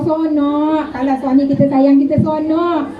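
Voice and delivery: normal talking voice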